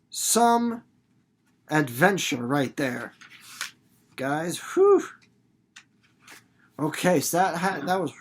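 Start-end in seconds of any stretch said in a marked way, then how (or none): 4.7-5.0 high loud whew; relieved whew. Shown as a demonstration. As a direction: exhale big relief. Acted relief.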